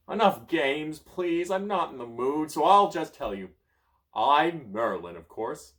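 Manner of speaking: high, loud, impatient wizard; cranky and full of himself; grouchy wizard. Acted. Merlin scolding the child, high and loud.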